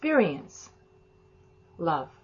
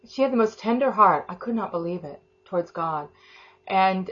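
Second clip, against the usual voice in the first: normal talking voice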